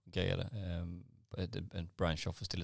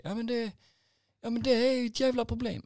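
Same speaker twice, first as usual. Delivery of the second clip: high, loud imitation of a fuming customer; exasperated high-pitched cursing, imitated; mock angry yell; 9 dB louder than usual. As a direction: protest in a hammed-up high rage. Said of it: "han är så jävla arg fortfarande".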